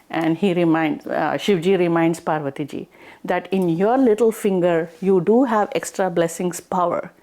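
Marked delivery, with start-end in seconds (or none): none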